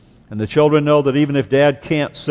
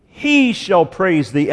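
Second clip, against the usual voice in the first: HE leaned on, drawn out slowly; stress on HE; 3 semitones higher, slower than usual. Shown as a demonstration. Preacher quoting the Hebrew emphasis, HE stressed. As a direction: lean slowly on HE, then finish the phrase. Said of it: "is emphasized in the Hebrew".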